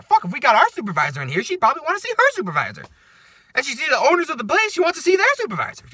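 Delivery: high, loud comic rant; exasperated. Retold or imitated. Worker venting about supervisor demands, exasperated. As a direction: climb into hammed-up disbelief.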